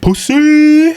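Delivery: high mocking yell; teasing shout. Real, not acted. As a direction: yell it out to tease.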